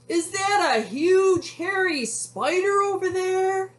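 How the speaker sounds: high, loud storybook panic; scared cowboy. A narrator's reading. Frightened cowboy spots a spider, high loud alarm. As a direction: cry out in exaggerated alarm. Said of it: "He's scared".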